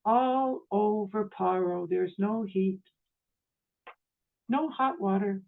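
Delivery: slow, serene imitation; unruffled, measured kindness; equanimity with compassion. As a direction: break it gently, with long calm pauses. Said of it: "said with such striking equanimity and yet compassion".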